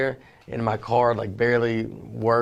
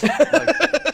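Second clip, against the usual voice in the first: high, loud laughing "like"; laughing burst; 13 semitones higher, 3 dB louder than usual. Real, not acted. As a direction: burst out laughing on one word.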